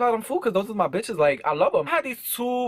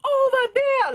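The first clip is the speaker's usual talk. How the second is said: mock Chinese lady shouting; much slower than usual. Restaurant lady shooing teens off her storefront, high mock yell. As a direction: shoo them away in a mock accent. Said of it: "and she's like".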